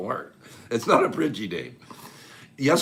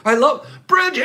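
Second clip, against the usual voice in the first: high loud cheerful yell; happy yell; 14 semitones higher, 8 dB louder than usual. Real, not acted. Host shouting love for a viewer, loud happy yell. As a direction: belt it out with joy. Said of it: "I wanna yell him all the way across".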